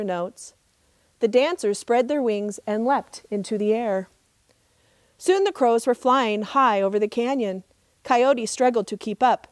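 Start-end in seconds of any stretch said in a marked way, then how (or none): none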